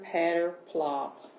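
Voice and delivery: ordinary delivery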